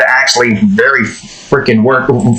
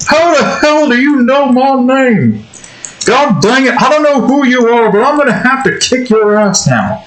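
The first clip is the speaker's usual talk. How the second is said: exasperated, higher-pitched cartoon dad threat; Hank Hill; 8 semitones higher than usual. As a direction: scold your son in a put-on cartoon voice. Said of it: "Mister Hill".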